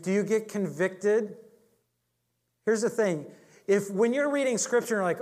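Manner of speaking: ordinary talk, nothing marked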